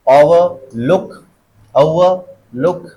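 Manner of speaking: overlook very slow, rising onto a stressed look. Shown as a demonstration. Teacher drilling compound verb stress, stress on look. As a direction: split overlook and lift look. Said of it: "stress कहाँ पे लगेगा".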